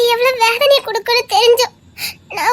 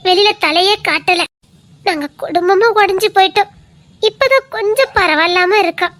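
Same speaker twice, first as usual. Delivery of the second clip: ordinary talk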